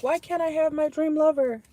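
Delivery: high, loud mock whine; mock whiny lover. Reader mimics lovelorn viewers, high mock whine. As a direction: play a pouting dreamer begging for love. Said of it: "Some of y'all are like".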